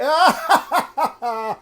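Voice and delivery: high loud jeering cackle; mocking cackle. Drunk ruffian jeering at the puppeteer, with a loud mocking cackle.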